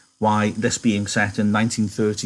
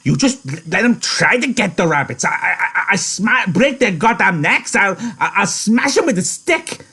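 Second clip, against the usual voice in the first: high, loud stammering threat; worked-up fury; 10 semitones higher, 5 dB louder than usual. A narrator's reading. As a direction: splutter threats, pitch climbing as the rage builds. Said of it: "Lenny breathed hard".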